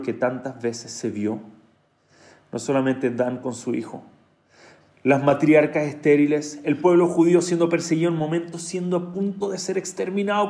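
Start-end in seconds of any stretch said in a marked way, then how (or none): none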